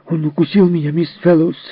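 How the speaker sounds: put-on high, angry, breathless cry of a bitten boy; Jerry tells on Timmy, high and upset; angry child complaint. A hurt boy complains to Miss Fellowes, high and angry. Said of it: "задыхаясь от злости, крикнул Джерри".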